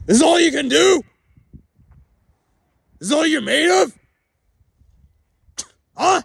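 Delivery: high, loud scornful shout; taunting yell. Real, not acted. Drunk man taunting life itself, scornful yelling. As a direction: taunt them at full voice.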